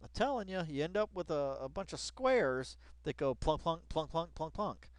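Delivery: ordinary talk, nothing marked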